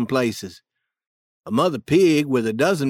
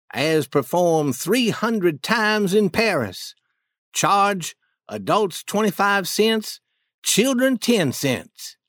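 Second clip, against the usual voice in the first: 1 poster read aloud; 2 semitones higher than usual. Acted. Reading out a show poster.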